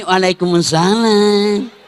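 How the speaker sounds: slow, drawn-out mock sultry reply; a hint of a seductive woman; swooning greeting. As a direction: coo it slowly like a temptress. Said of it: "itu tadi sahdu namanya".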